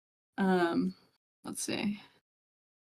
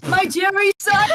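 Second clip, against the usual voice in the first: high cute anime squeal; kawaii voice; 10 semitones higher, 4 dB louder, slower than usual. Shown as a demonstration. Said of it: "a kawaii voice".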